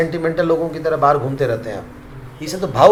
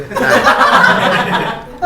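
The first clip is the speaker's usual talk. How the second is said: loud burst of laughter; 8 semitones higher, 8 dB louder than usual. Real, not acted. Laughing at own joke.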